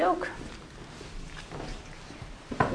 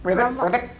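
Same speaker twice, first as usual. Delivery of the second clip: croaking like a frog; 5 semitones higher than usual. Shown as a demonstration. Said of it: "It's a funny sort of sound".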